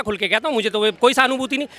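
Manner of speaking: ordinary delivery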